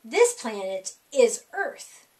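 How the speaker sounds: ordinary delivery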